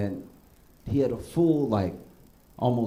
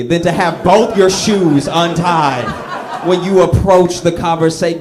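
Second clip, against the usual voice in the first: much higher and louder, cracking up; loud laughing rant; 10 semitones higher, 12 dB louder than usual. Real, not acted. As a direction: shout it through your own laughter. Ranting about untied shoes, laughing.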